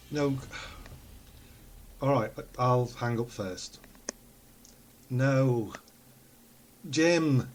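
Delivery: normal talking voice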